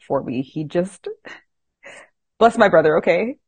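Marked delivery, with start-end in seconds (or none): 1.7-3.4 laughing. Real, not acted. Laughing at brother's ordeal.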